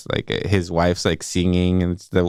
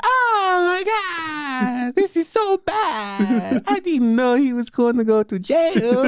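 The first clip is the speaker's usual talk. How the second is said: high, drawn-out wailing Italian caricature; over-the-top squealing movie Italian; Jared Leto; slower than usual. Retold or imitated. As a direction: wail it in a hammy fake Italian voice. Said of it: "It's Jared Leto be like".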